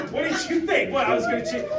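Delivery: high, loud mocking jab; mock him, voice jumping up; sarcastic high-pitched jab at a rude customer; sarcastic retort. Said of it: "And I go".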